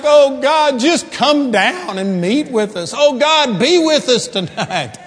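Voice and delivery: loud, high, pleading mock prayer; hammed-up appeal to heaven. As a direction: imitate a congregant crying out to God. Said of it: "We pray things like".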